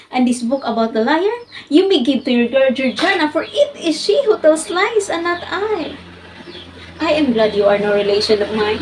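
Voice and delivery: forceful, deliberate declarations of dislike; blunt defiance. Acted. Jane Eyre confronts her aunt Missus Reed, blunt and defiant. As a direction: stand up to her and punch the key words. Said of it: "launched them in this blunt sentence".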